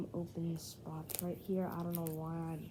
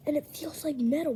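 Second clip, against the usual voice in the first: excited; 7 semitones higher, 8 dB louder, faster than usual. Real, not acted. Excited discovery of metal card.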